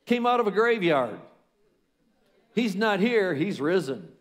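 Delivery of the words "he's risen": ordinary delivery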